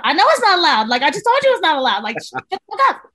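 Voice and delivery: Mary snapping at her panicking trainee, exasperated. Retold or imitated. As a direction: lose patience with the nervous trainee.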